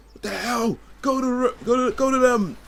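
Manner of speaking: high-pitched mock manager yelling; stumbling, hammed-up telling-off at a high pitch; retold angry shout. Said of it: "Dude started shouting".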